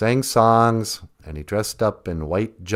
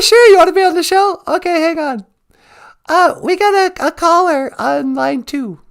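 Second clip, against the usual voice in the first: very high, bright put-on host voice, much louder; a chirpy fake DJ picking up the phone; cheerful host answering a caller on air, squeaky and loud; radio host voice; 6 dB louder than usual.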